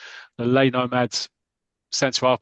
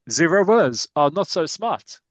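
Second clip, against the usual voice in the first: slow, higher mock French accent; 4 semitones higher, 3 dB louder than usual. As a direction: jeer as a French fan with a put-on accent. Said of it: "one of the French crowd".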